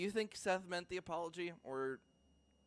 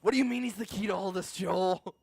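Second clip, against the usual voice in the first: mock villain voice; 4 semitones higher, 7 dB louder than usual. Mock villain quarrel with Joel.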